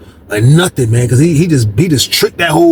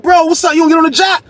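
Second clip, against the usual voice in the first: high, raised call across the room; shouting across; 13 semitones higher than usual. Retold or imitated. Asking Jersey across the pod why he's off the phone, shouted. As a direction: shout it over to him.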